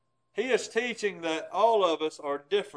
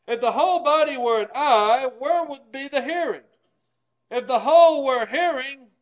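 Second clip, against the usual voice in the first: raised, preaching read of the verses; scripture reading; 4 semitones higher, 5 dB louder than usual. Acted. Preacher reading Paul's letter to the church, raised.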